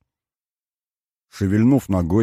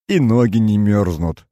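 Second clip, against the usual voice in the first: usual speaking voice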